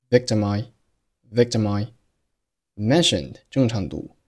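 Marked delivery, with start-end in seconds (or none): 0.1-1.9 very slow "victimi", m running into I; "victim I" run together as one word; linked victim I. Shown as a demonstration. Teacher slowly showing the "victim I" linking. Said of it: "读成了".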